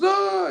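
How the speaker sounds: loud, high mock scream of alarm; imitated alarmed cry. Nurse sees dad scoop up the baby, imitated high alarmed cry. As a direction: let out a panicked "ah" as the nurse.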